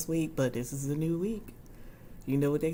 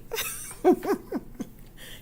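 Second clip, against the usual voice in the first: high breathy laugh. Real, not acted. Laughing while reading chat.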